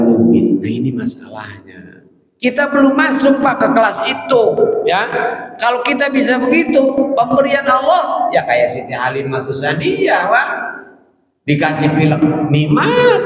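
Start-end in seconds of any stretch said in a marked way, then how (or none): 2.4-8.3 much higher, fast and fervent; fervent preaching. Acted. Preacher urges the audience toward acceptance. 12.5-13.2 far higher, ringing out; soaring exclaim. Acted. Preacher exclaims that a cold is a blessing.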